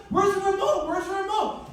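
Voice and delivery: high, loud frantic shout; panicked yell. Retold or imitated. Panicked search for remote.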